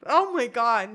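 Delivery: normal talking voice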